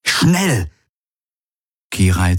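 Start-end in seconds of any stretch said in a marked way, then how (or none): 0.1-0.7 much higher, sharp order; urgent command. A narrator's reading. Galen orders Kirai to hurry, urgent. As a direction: snap it out quickly.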